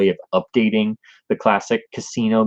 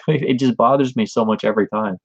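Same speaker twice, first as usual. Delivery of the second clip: normal talking voice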